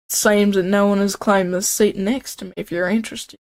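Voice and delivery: put-on amateur Southern accent, easygoing offer. Colonel Sanders offering the seat beside him, Southern accent. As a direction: do your best Southern accent. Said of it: "that's my best Southern accent".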